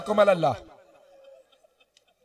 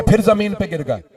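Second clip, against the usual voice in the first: ordinary talk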